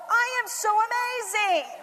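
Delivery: high, loud self-praise; proud declaration. Retold or imitated. Mom announces to her family, high loud boast. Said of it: "I go".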